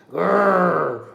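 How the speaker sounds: loud rumbling animal growl; coyote growl. Acted. Coyote growls at the plump lamb, loud growl. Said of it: "He growled".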